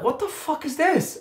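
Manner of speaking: high, baffled "what is this"; a stunned, high-pitched what the; baffled outburst. Retold or imitated. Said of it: "I'm like".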